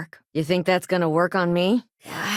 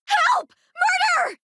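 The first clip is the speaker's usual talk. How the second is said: screaming for help; much slower than usual. Acted. Bystander screams for help at a murder, panicked shout. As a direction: shriek for help as if witnessing a murder.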